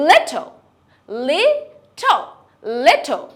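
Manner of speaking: little said sharply, British. Shown as a demonstration. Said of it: "ব্রিটিশরা will say".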